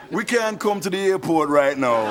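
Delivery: put-on slow, laid-back Jamaican accent; unhurried Jamaican voicemail greeting; Jamaican answering machine. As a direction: leave a lazy Jamaican recorded message. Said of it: "The guy's like".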